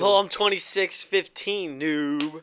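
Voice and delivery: slow, high smug taunt; mocking cocky gamer. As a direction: sneer it like a cocky pro player.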